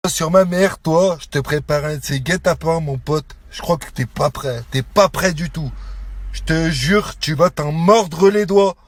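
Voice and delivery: real threat in a low, controlled voice; low menace. Real, not acted. Man promising his rival an ambush, low menace. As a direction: drop low and promise payback.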